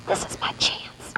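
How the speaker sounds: hushed, secret whisper. Acted. Girl planning a theft whispers to herself. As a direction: breathe it like a secret. Secret thought during heist.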